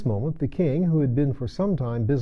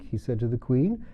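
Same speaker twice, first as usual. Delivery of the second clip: plain reading voice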